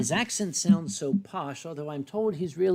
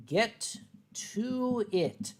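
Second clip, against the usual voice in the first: very slow, stretched words; drawn out while writing; 4 semitones higher, much slower than usual. Real, not acted. Artist writes advice to the fox, very slowly. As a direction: stretch each word as you write it.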